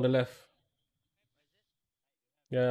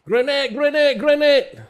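grenade warning yell; 13 semitones higher, 10 dB louder, slower than usual. Real, not acted. Grenade incoming in firefight.

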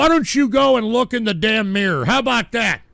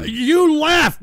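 louder, higher-pitched angry outburst; indignant retort; 6 semitones higher, 5 dB louder than usual. Real, not acted. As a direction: cut in with raised, accusing voice. Indignant retort to returning caller.

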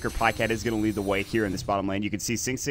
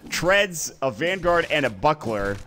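usual speaking voice

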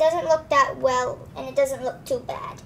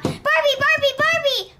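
urgent calling; 11 semitones higher, 4 dB louder than usual. Acted. Child calling Barbie in alarm.

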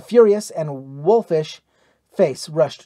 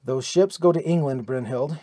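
ordinary delivery

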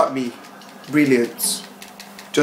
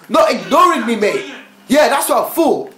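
taunting; 6 semitones higher than usual. Acted. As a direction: taunt the shopkeeper. Robbing store taunting.

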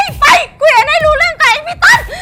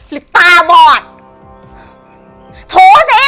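low, haughty sneer; cold contempt; 9 semitones lower than usual. Acted. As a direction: look down on her and scold coldly. Mistress scorns the maid.

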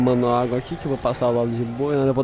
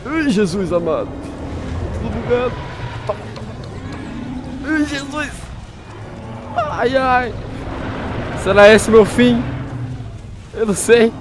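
high, strained panicky cries; frightened; 8 semitones higher than usual. Real, not acted. Player fleeing attackers in mock despair. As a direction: cry out as if doomed.

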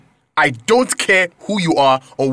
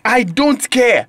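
angry; 7 semitones higher, slower than usual. Acted. Angry outburst.

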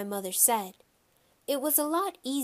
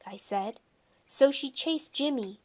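ordinary delivery, nothing marked

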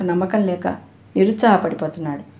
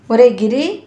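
higher, louder call with rising end; 4 semitones higher, 6 dB louder, slower than usual. A narrator's reading. Mother in the kitchen summoning her son, calling out. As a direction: call a name through to another room. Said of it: "వంటగదిలోంచి కేకేసింది Subhadramma".

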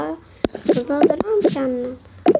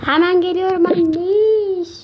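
calling out; 6 semitones higher, 4 dB louder, slower than usual. Acted. Calling out to mother.

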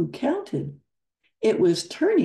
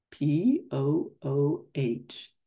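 P O O H, letter by letter, slow; spelled out slowly; slower than usual. Shown as a demonstration. Pooh spelling his name as he prints it, slow letters. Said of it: "he printed slowly".

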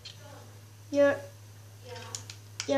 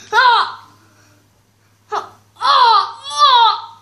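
loud, high cries in bursts; alarmed yells; 9 semitones higher, 10 dB louder, much slower than usual. Real, not acted. Player trying to get through, alarmed yells. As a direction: yelp out loud, high and repeatedly.

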